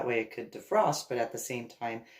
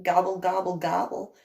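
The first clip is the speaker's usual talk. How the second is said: higher, louder mock turkey gobble; 4 semitones higher, 5 dB louder than usual. Sarcastic kid answering mom's "turkey" jab, mock gobble. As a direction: gobble back at mom like a cheeky turkey. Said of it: "I was like".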